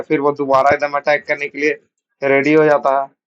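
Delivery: ordinary delivery, nothing marked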